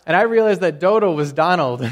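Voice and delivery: loud, laughing realization; laughing punchline. Real, not acted. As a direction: deliver the punchline through laughter.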